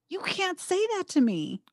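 high indignant pushback; indignant retort. Retold or imitated. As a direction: snap back, offended. Retorting to empty reassurance.

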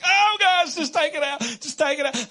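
jokingly frightened husband; mock panic. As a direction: beg the crowd for help, hammed-up. Mock-panicked plea to audience.

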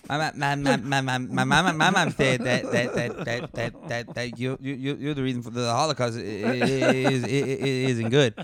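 stammering, stuck-on-each-word character voice; Waterboy Sandler. Acted. Joking about which Sandler to cast, stammering character impression. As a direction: put on a goofy stutter, repeating words before getting them out. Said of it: "What about Waterboy Sandler?".

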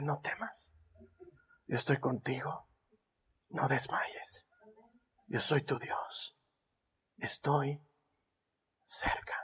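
hushed unvoiced whisper; God whispering. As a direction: whisper reassurance gently. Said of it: "y te dice".

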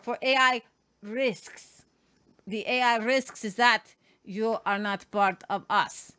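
mock European villain accent; bad guy accent. Host twisting the EU chief's AI speech, villain accent. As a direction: declaim like a put-on foreign bad guy. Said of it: "this is my bad guy helmet".

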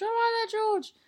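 high comic greeting to George; high squeaky greeting. Retold or imitated. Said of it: "reading fan's greeting".

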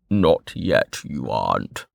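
low, even, detached voice; cool Westbridge. A narrator's reading. Westbridge correcting Velika, low and cool. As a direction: say it coolly, unmoved. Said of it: "he said coolly".

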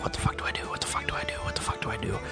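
panicked whisper. Retold or imitated.

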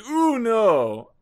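hammed-up high, loud cry; mock horrified yelp; mock alarm. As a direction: squeal it in fake shock. Mocking the mother's reaction.

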